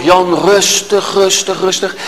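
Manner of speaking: slow, self-soothing inner voice; slow mock self-calming; calming himself down. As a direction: talk yourself calm, drawn out. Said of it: "Dus ik denk nog".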